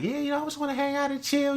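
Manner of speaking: high, fast, soft breezy woman's voice; mock casual woman. Leo woman casually inviting him over, high, fast and soft. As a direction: toss it off lightly like a carefree girl.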